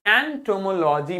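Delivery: एंटोमोलॉजी slowly in clear syllables; pronunciation demo. Shown as a demonstration. Teacher has children repeat after her, slow syllable-by-syllable एंटोमोलॉजी. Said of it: "मेरे साथ में बोलिए".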